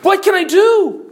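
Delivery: high, loud cry of alarm; terrified cry. Acted. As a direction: cry out helplessly in acted terror. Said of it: "Miss Church Member is terrified".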